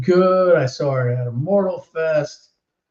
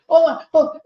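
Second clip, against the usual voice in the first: put-on squealing excited kid; 5 dB louder than usual. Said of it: "He's like".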